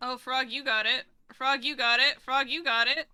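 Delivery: loud chant-like cheer, each "Frog, you got it" rising; urgent loud encouragement; excited cheering. Real, not acted. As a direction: hype up your teammate. Cheering teammate on repeatedly.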